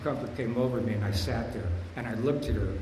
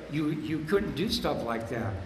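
ordinary delivery

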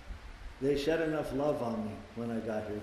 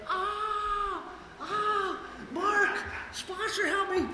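high, hammed-up cry for help to the sponsor; mock panic; 4 dB quieter, much slower than usual. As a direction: squeal for the sponsor, slowly. Newcomer panicking, calls sponsor.

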